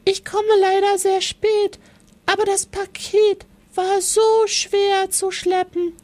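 a tiny mouse calling to the turtle; small mouse voice. Acted.

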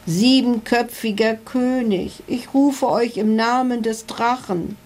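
a lightly voiced scared knight addressing the dragon; stammering knight. A narrator's reading. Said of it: "stammelte Ritter Kunibert".

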